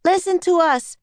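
high, urgent yell to a crowd; boy's loud yell. A narrator's reading. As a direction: yell high to be heard by everyone. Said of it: "Jack yelled in his loudest voice".

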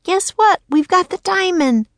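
put-on high little-girl voice, croaked; a girl turned raven, croaking. Annie to Jack in the nursery, high croaking girl.

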